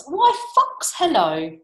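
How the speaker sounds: very high, loud hail; high, bold storybook mouse; greeting mouse. Acted. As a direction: pipe it up in greeting.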